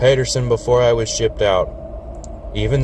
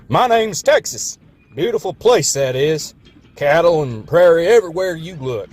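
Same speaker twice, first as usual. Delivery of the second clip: put-on ridiculous Texan accent; 6 semitones higher than usual. A narrator's reading. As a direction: brag like a cowboy. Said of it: "that ridiculous accent".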